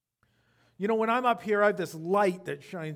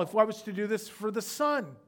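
ordinary talk, nothing marked

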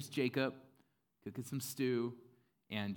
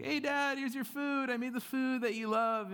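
high, loud, cheery son; eager Esau; 6 dB louder than usual. Retold or imitated. Esau brings Dad his food, eager high call.

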